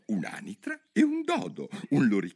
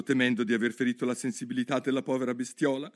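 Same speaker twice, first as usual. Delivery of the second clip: plain reading voice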